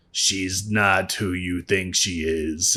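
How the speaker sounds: low, slow, soft sinister voice; menacing hush of a dark lord; ominous villain line. Retold or imitated. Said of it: "he's like".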